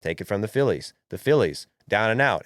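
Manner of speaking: ordinary delivery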